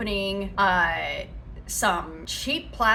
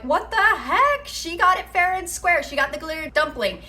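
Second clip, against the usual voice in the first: indignant commenters; 8 semitones higher, 4 dB louder than usual. Retold or imitated. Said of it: "Everybody is saying".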